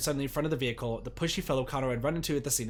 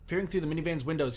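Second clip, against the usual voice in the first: usual speaking voice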